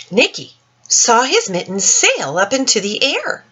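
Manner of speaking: put-on gentle, lilting read-aloud for children; story reading. Teacher reading The Mitten to children, lilting storytime voice. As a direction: read it like storytime to little ones. Reads picture book aloud.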